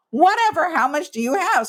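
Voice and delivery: high, loud brushing-off voice; dismissive friend. Retold or imitated. Friend Lisa brushing off her loss, mock dismissive. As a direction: wave the complaint away.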